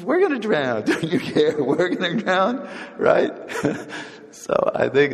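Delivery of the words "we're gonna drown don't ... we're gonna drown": high, hammed-up panic; panicked disciples. Disciples in the sinking boat panicking at Jesus, high mock panic. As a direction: play the frightened disciples crying out in the storm. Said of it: "He says".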